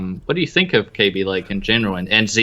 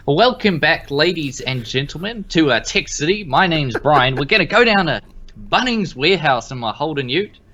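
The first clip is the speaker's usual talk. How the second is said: higher-pitched mock Aussie intro; Australian host impression; 5 semitones higher than usual. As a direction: put on a broad Australian accent for a show intro. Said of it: "He's gonna do my intro".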